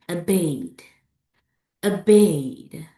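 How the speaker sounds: OBEYED with a long, stressed BEYED; light o, weight on BEYED in OBEYED; second-syllable stress. Shown as a demonstration. Teacher models second-syllable words, stress on BEYED. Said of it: "Examples of words with stress on the second syllables".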